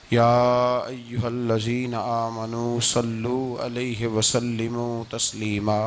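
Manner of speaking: low, measured sacred recitation; Arabic recitation. Acted. Preacher opens his sermon with Quran verse and blessings, solemn recitation.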